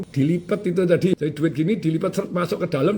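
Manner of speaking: ordinary talk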